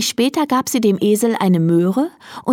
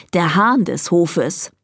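ordinary delivery

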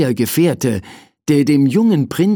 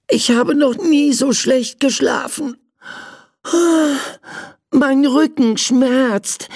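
complaining little prince; 12 semitones higher than usual. Acted. Prince to servant after bad night, pained high whine. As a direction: lament your aches in a high child voice.

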